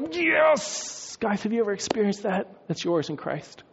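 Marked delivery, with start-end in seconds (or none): 0.1-1.2 high, drawn-out cry of excitement; exultant yes. Acted. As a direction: let it out as if shaking a friend. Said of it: "shake each other and go".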